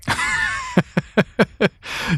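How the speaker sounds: high burst of laughter; amused high cackle; real laugh. Real, not acted. Laughs at his own joke.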